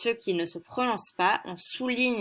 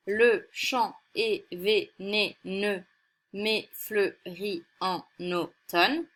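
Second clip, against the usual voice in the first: slow verse reading; much slower than usual. Shown as a demonstration. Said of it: "comme l'alexandrin, qui est un vers de douze syllabes".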